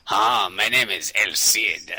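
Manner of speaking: grand, drawn-out self-introduction; slow, loud mock-heroic announcement; El Cid. Picturing El Cid for a co-host, hammed-up slow hero voice. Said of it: "I always picture El Cid as, as being".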